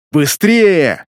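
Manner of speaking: loud urgent yell. A narrator's reading. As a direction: bellow hurry up. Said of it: "заорал княжич". (громкий крик)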